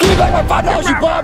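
high, angry yell; furious demand. Acted. Boy attacking homeless man for his money, enraged yell. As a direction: burst out screaming for it back.

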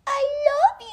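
squeaky high and loud love you; high baby-talk voice. Shown as a demonstration. Prompting his dog to answer, squeaky high coo. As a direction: coo it up high to make him bark. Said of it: "But then I'd be like".

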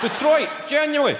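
mock amazed shout; excited shout. Real, not acted. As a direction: shout it in total disbelief. Repeats Detroit in mock awe.

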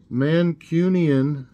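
drawn out, careful and loud; reading an unknown word slowly; slow sounding out. Real, not acted. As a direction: spell the strange word out slowly.

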